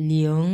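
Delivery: লিয়ং held, starting low and lifting; sustained rising-tone লিয়ং; second tone. Shown as a demonstration. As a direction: glide লিয়ং upward as lee-ong. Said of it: "এটাও দুই number tone".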